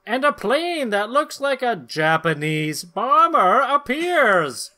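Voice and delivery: hammed-up narrator; story narration. A narrator's reading. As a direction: read it like a serial announcer. Narrates comic panels.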